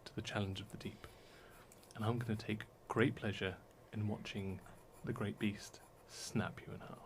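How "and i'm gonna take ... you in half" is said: menacing whisper. Acted. Regina threatens the prisoner.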